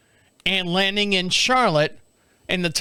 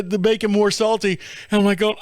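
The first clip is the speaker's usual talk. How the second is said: ordinary talk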